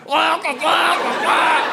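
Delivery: high, slow puking-cat noises; exaggerated glugging retches; imitated cat retching. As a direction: mimic a cat heaving up vomit. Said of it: "It was my cat Miso going".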